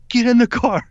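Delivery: sudden high, loud order to get in; urgent yell. Retold or imitated. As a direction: bark it like a getaway driver in a panic. Cousin urging escape, shouting.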